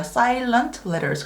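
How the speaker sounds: slow, careful model of a term; drawn-out teaching pronunciation; term demonstration. Shown as a demonstration. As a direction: say it slowly for learners to catch. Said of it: "silent letters라고 해요".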